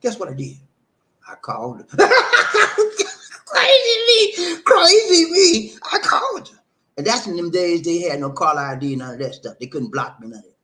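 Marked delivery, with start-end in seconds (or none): 2.0-6.6 very high, loud laughing wail; hysterical laughing. Real, not acted. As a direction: shriek it slowly through laughter. Cracking up at his past self.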